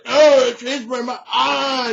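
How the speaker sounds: loud, high wailing scream; agonized yell. Acted. Knight having wounds cauterized, agonized acted scream. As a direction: shriek as if being burned. Bernard is burned by glowing hands.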